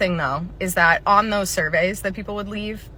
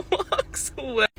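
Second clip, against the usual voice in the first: usual speaking voice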